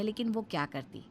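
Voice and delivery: usual speaking voice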